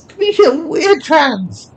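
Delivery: high loud mocking squeal; gleeful squeal. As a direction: squeal it out, delighted to taunt. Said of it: "female voice squealed gleefully".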